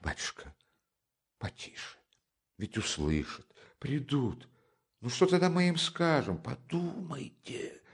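hushed, frightened pleading whisper; terrified whisper. Acted. Porfiry begs Raskolnikov to be quiet, whispering in terror. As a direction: hush him before anyone hears. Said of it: "прошептал в ужасе".

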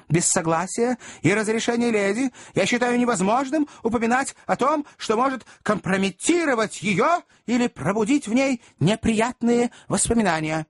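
high-pitched formal protest; earnest gentleman standing firm; dignified, indignant Pickwick. A narrator's reading. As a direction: refuse on principle, voice held high. Pickwick voiced in narration.

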